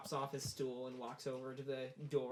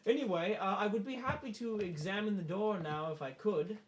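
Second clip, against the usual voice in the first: higher-pitched, apologetic official; courteous visiting dignitary; 6 semitones higher, 3 dB louder than usual. Acted. Late visitor to host, courteous high voice. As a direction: speak up high with polite formality.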